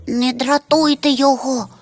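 a hushed plea in a small high voice, not truly whispered; timid tiny creature. A narrator's reading. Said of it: "прошепотіло крихітне звірятко". (тоненький голосок наляканого звірятка)

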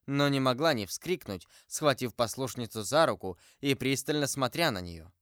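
ordinary delivery, nothing marked

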